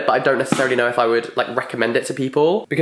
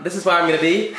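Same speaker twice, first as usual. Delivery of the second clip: ordinary delivery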